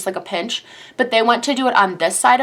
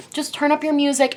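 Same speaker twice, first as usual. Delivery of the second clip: hushed, higher comforting tone; kind soothing nurse; 6 semitones higher than usual. Retold or imitated. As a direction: imitate a nurse gently coaxing a patient. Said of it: "She's like".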